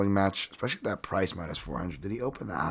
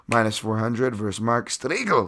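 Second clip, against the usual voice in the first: usual speaking voice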